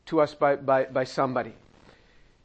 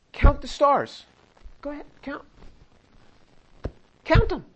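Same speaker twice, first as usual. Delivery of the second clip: playful command; 6 semitones higher than usual. Acted. God commanding Abram to count.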